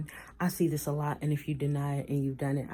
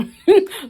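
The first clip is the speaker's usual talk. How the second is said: high, loud laugh; bright laugh burst; 12 dB louder than usual. Real, not acted. Laughing at the idea of caring, high and loud.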